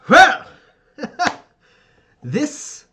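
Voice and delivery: hearty laugh into grand, drawn-out pronouncements; Ferdinand, domineering father. Acted. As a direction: boom approval, then give a stern order.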